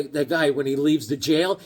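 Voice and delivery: ordinary talk, nothing marked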